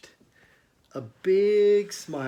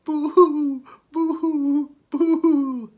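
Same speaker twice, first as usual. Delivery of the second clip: loud high boo-hoo sobbing; crying giant; 13 semitones higher, 11 dB louder, much slower than usual. Acted. Giant crying in the museum, hammed-up high boo-hoo sobs. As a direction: wail like a big giant weeping far away. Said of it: "It sounded like this".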